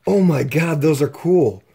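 normal talking voice

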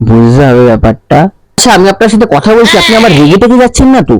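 ordinary talk

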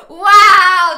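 loud sarcastic wow; shouted mock outrage. Real, not acted. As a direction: belt the wow in disbelief. Reacting to mean mom text.

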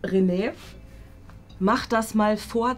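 usual speaking voice

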